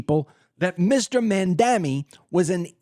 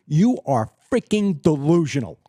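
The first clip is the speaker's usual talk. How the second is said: usual speaking voice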